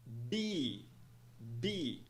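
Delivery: "Be" as one syllable with long EE; teacher's isolated model of "Be"; long I vowel. Shown as a demonstration. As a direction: say "Be" low and falling, vowel like "see". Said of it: "que soa como I".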